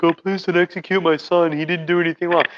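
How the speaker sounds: mother begging the Tsar, mildly mock pleading. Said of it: "his mom wrote a letter and was like".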